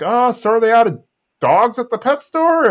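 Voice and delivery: high, dry mock sarcasm; mock sarcastic Hank. Hank mocking Bill's iguana at dinner, high sarcastic voice. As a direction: needle him about the pet store.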